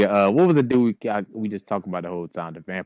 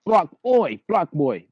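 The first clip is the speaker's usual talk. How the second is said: mock northern English voice; a playful North England accent; 5 semitones higher, 4 dB louder, slower than usual. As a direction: imitate a northern English bloke, barking it out. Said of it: "they got the North England out there like".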